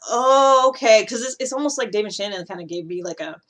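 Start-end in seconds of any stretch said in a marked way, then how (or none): 0.1-1.0 drawn-out, higher, louder "oh, okay"; dawning realisation. Acted. Speaker finally getting a name for her intuition, dawning realisation. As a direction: let it click, stretching the oh.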